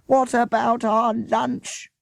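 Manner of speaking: put-on high, slow childish wail. A narrator's reading. Hungry children complaining to each other, wailing. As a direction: whine for food like starving kids. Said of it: "wailed the children".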